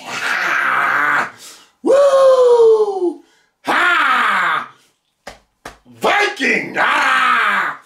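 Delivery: high, hammed-up warrior whoops; battle cries. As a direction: shout away the tears like a Viking. Psyching self up against tears.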